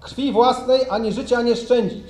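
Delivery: solemn, measured oath dictation; formal oath reading. Real, not acted. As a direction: read each oath line out for the ranks. Officer reading the oath line.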